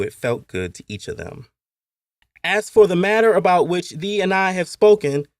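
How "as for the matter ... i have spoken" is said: put-on high, loud child reciting solemn words; boy quoting scripture. A narrator's reading. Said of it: "Kumi had quoted out of the blue".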